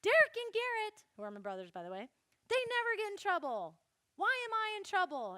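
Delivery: whiny protest. Retold or imitated. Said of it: "I was really mad".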